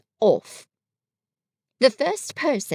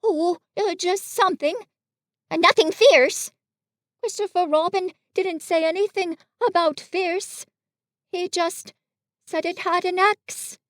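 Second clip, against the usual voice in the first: high, halting bear voice; Pooh, vaguely reassuring; 8 semitones higher than usual. Acted. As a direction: fumble for an answer, pitched well up.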